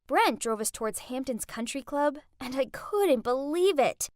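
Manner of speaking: plain reading voice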